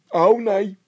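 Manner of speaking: high, loud panicky voice; frightened Bol. A narrator's reading. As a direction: panic about the noise. (Bol in paniek, hoog en luid)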